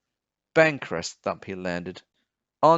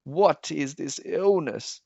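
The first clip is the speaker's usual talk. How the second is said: croaking sick king; 5 semitones higher than usual. Acted. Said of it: "He croaked".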